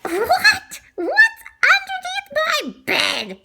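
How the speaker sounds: high, laughing disbelief; shocked squeal. Acted. Child hearing of an alligator, high laughing shock. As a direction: squeal it out in amused alarm.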